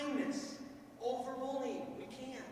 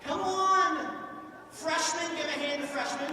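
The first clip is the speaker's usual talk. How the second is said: loud, high call across the hall; rally the crowd for volunteers; shouting to a gym full of students; 6 semitones higher, 11 dB louder than usual. Real, not acted.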